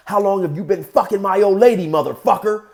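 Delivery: acted jealous anger; angry demand. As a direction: demand it like a wronged boyfriend. Acted last demand before fight.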